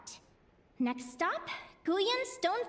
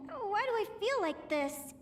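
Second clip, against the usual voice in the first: ordinary talk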